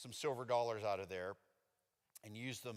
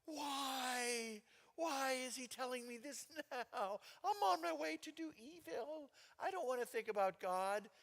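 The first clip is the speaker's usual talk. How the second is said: high, whining, put-upon voice; higher whine dodging God; whiny protest; 13 semitones higher, slower than usual. Retold or imitated. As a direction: complain like a guilty kid. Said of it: "And I'm riding my bike".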